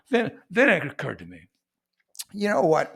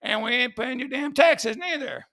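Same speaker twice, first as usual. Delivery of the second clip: mock defiance; 7 semitones higher than usual. Boston tea party defiance acted.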